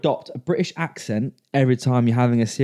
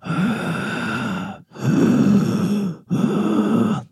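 Vader gasp; 8 semitones higher than usual. Acted. Darth Vader breathing imitation.

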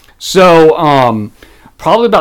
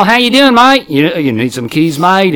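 higher, cheery fake Australian greeting; Australian accent; 3 semitones higher than usual. Worker approaches Australian customer about keys, put-on Australian accent. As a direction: offer service as a put-on Australian. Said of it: "haven't done an Australian accent".

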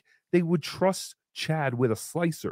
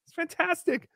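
high sung-out fantastic; high catchphrase; 11 semitones higher, 3 dB quieter than usual. Acted. Recurring catchphrase voice.

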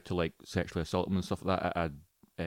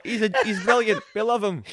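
mock crowd adoration; 12 semitones higher, 5 dB louder than usual. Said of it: "everyone applauded him and thought".

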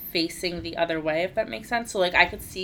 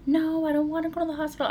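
put-on much higher, quick childish protest; panicked child; 10 semitones higher, faster than usual. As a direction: blurt it out like a scared kid. And he's like.

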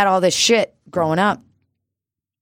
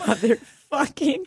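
laughing echo; 11 semitones higher than usual. Real, not acted.

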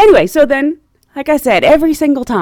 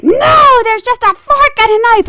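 very high, loud mimicked protest; angry old lady; 14 semitones higher, 6 dB louder than usual. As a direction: insist hotly as an exasperated passenger. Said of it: "these ladies had some anger".

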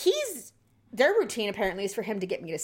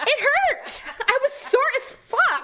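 very high, loud comic complaint; worked-up indignant outburst; exasperated protest; 4 dB louder, slower than usual. Real, not acted. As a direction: wail the complaint high, half laughing. Indignant retelling of post-surgery pain.

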